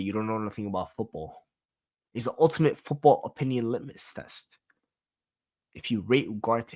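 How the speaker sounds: usual speaking voice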